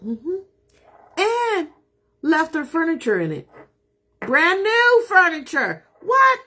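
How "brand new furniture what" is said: high, loud disbelieving exclaim; amazed. Real, not acted. Realtor tells viewers the deal, loud high amazement. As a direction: sound floored by the news.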